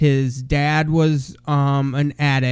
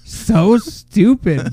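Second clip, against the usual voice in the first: scoffing; 5 semitones higher, 4 dB louder, much slower than usual. Real, not acted. Mocking a dumb movie idea.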